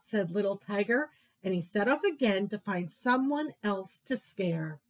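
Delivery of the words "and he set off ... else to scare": usual speaking voice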